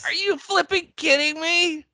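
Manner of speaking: very high, astonished squeal of words; can't believe it, voice sky-high; incredulous outburst. Real, not acted. Stunned by actor's identity.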